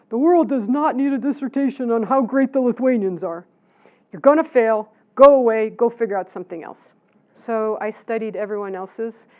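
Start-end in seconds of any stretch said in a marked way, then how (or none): 0.1-6.9 loud, emphatic mock dismissal; imitated PhD supervisor. Supervisor rejecting a student's dissertation idea, loud mock scolding. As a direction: play the blunt supervisor laying down the law. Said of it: "my P H D supervisor sat me down and said".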